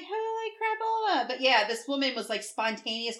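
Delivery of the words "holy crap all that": high, exaggerated gasp of awe; imitating her own wowed reaction; light hammed-up surprise; mock amazement. Her reaction to sequin joggers.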